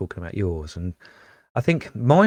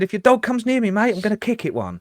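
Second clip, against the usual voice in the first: much higher mock threat; warning him off; 11 semitones higher, faster than usual. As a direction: warn him off the dog, pitched right up. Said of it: "I said".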